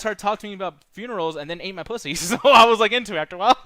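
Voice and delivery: loud, fast, laughing crude punchline; loud laughing punchline to cohost; laughing joke. Real, not acted. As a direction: blurt it out through laughter. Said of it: "joking loudly while laughing".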